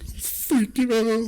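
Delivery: squeaky falsetto freak-out; hammed-up panic, high and drawn out; mock panic. Repeats panicked answer.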